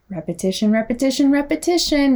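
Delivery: "repetition" three times, climbing in pitch; emphatic repetition. Shown as a demonstration. Repeats the word for emphasis.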